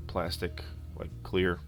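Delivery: ordinary talk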